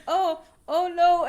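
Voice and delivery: high, slow, loud "oh no"; hammed-up dread as it dawns on you; mock dismay. Acting out the reader's dismay.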